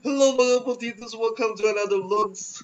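lightly voiced vlogger greeting; a hint of a cheerful YouTuber; vlogger impression. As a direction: do the potato vlogger's hello.

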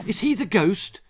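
put-on high, eager child's question; eager child interrupting. A narrator's reading. Child interrupts grandma's tale to ask, eager. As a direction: jump in high and keen.

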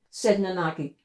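plain reading voice

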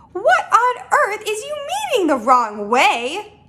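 high, slow incredulous question; baffled questioning. Acted. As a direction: ask in drawn-out disbelief. Giant baffled by Sophie's objection.

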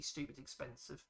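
ordinary talk

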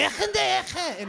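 rough imitation of Middle Eastern speech; mock Middle Eastern language. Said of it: "any Middle Eastern group".

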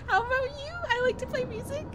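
very high little voice; high cutesy introduction. Acted. Acting out a meet-and-greet.

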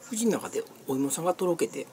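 ordinary talk, nothing marked

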